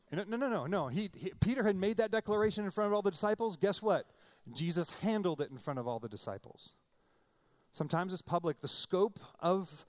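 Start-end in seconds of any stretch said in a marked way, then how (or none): none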